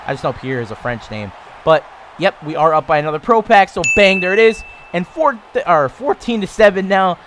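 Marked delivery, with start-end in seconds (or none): 1.6-7.2 louder, higher, hyped finish; excited celebration. Real, not acted. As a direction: celebrate the score out loud. Celebrating going ahead.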